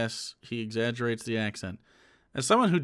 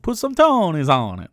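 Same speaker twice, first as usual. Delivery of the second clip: put-on a light Italian accent; light Italian-accented quip; mock Italian accent; 3 semitones higher, 8 dB louder than usual. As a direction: tease her with an Italian lilt. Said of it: "with an Italian accent".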